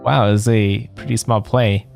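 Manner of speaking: ordinary talk, nothing marked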